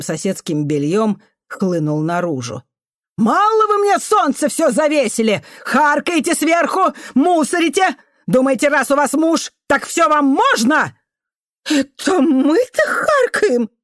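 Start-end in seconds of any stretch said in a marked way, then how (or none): 3.2-11.0 high, loud angry tirade; furious outburst. A narrator's reading. Janitor woman shouting up at the neighbour, furious. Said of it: "Гнев, годами копившийся". 11.7-13.7 high, slow, outraged gasp; choking indignation. A narrator's reading. Neighbour woman on the balcony, accused of spitting, indignant. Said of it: "задохнулась от возмущения".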